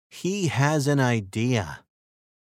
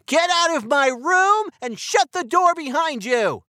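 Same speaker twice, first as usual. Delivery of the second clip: high, loud angry yell at his little brother; boy yelling at his brother, loud and high; 4 dB louder than usual. Acted. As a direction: shout him out of the room. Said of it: "he yells".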